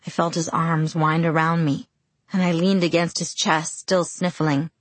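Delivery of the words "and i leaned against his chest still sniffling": usual speaking voice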